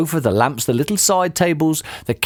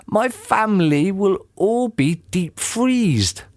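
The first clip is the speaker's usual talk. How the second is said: slow, higher-pitched worry; worried cry; 7 semitones higher, slower than usual. A narrator's reading. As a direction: draw it out in dismay. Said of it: "cried Mugglewump".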